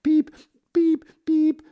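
high, loud bird cries; loud frightened peeping. Acted. Startled canary crying out in its shaken cage. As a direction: peep in alarm like a shaken canary. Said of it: "es ruft laut".

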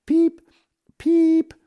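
high, tiny bird peeps; canary chirp. Acted. Canary greeting visitors with a high peep. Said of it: "ruft er".

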